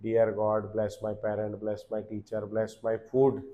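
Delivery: put-on soft, slow childlike prayer recital; mock child's prayer. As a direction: recite it like a small kid before lunch. बचपन की प्रार्थना की नकल. (खाने से पहले बच्चों जैसी धीमी, नरम प्रार्थना)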